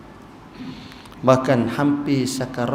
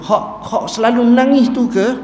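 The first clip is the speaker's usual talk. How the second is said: high, loud startled question; a shepherd recognises the weeping man, surprised; 11 semitones higher, 5 dB louder than usual. Retold or imitated. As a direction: blurt it out like a surprised herdsman. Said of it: "Maka pengembala ni kata".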